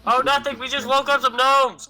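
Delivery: yelling over cliff. Acted. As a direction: shout an answer down to companions. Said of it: "I'm yelling over the cliff".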